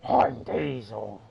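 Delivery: a light hint of a stuffy, sick engine; mock sick Diesel. A vlogger imitating the American dub's sick-sounding Diesel. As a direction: say it slowly, like a bunged-up engine. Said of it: "all like this".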